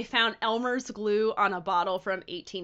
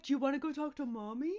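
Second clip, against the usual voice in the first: put-on baby talk to dog; 4 semitones higher, 6 dB quieter, faster than usual. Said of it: "he's literally like".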